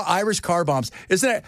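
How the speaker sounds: normal talking voice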